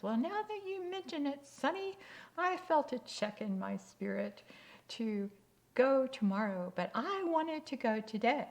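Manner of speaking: put-on high, loud old lady voice; imitated elderly woman making her admission; little old lady. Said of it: "this little old lady".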